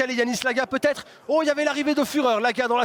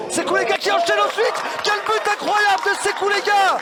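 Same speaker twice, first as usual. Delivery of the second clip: rising, excited commentator shout; excited goal call; 9 semitones higher than usual. Real, not acted.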